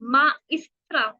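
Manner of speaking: माइस्ट्रा said slowly, syllable by syllable, ending on a clear a; Spanish maestra. Shown as a demonstration. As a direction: spell out माइस्ट्रा slowly for the class. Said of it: "आप मुझे कैसे बोलोगे?". (माइस्ट्रा धीरे-धीरे, साफ़ आ पर खत्म करते हुए)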